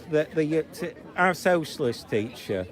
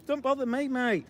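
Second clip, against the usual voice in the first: squealing high mock child, much higher; mock toddler voice; 10 semitones higher than usual. Filmer acting like a two-year-old to mock the protesters, squealing. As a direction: ham up a whiny toddler. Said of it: "I like to act like".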